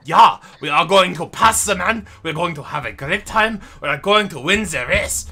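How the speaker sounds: loud, high hammed-up German racing boss; mock German accent. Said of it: "the German dude's like".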